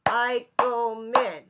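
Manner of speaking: Eichelman drawn out slowly in three beats, Ei-chel-man; syllables. Shown as a demonstration. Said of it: "is three syllables".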